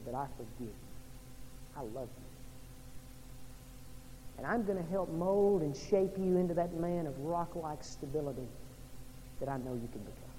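soft, low, reassuring voice; tender forgiveness. Retold or imitated. The risen Lord forgives Simon Peter after his denial, tender low voice.

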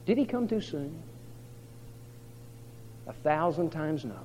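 ordinary talk

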